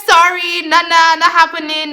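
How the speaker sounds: high, loud mock protest; hammed-up apologetic refusal; flustered refusal. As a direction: back out of it emphatically. Backing off, refusing him.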